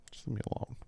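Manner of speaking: breathy hushed whisper; a pretend plea to be left alone, whispered. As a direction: mutter it under your breath. Mock plea, whisper then shout.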